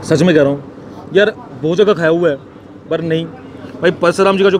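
chewing while talking; eating and talking; mouth full of meat. Real, not acted. Seen 1: Holds meat on bone; cheeks and jaw suggest chewing while talking.

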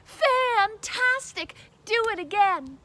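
high, loud, drawn-out cheer; loud urging cry; excited shout. A narrator's reading. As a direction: shout it with gleeful emphasis. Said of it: "Elizabeth shouted".

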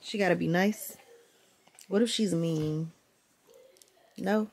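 ordinary talk, nothing marked

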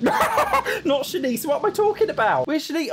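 high, giggling self-correction; laughing disbelief. Real, not acted. Player realising the wrong Sim died, high laughing disbelief. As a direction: laugh at your own mix-up.

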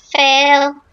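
high, loud mocking "fail". Real, not acted. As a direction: sneer it like a taunt.

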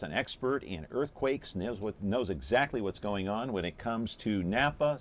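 usual speaking voice

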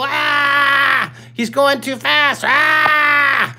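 high, loud, hammed-up panic shout; a teasing imitation of a student freaking out; mock panicked kid. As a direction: squeal like a kid who can't keep up. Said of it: "Don't go".

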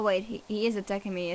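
normal talking voice

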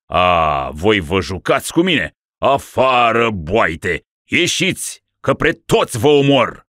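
loud, raging threats; furious. Acted. Dying ruler raging at the monks. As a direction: drive them out in a rage. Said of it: "cu mânie".

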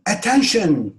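loud, high call; shouted warning. Shown as a demonstration. Teacher models warning someone, shouted. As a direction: shout to make someone watch out. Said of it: "diye bağırıyorsunuz".

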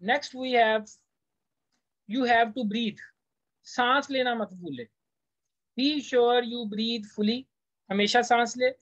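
usual speaking voice

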